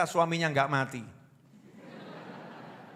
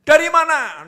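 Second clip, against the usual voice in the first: "Dari mana?" asked much higher and louder, sharp; 9 semitones higher, 8 dB louder than usual. Shown as a demonstration. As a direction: demand "Dari mana?" in a high, loud tone. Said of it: "Nadanya tinggi". ("Dari mana?" dengan nada tinggi dan keras)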